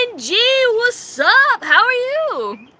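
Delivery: high, loud, drawn-out hello; excited greeting. Real, not acted. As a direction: call out a big slow greeting to a friend. Calls out a greeting to viewer.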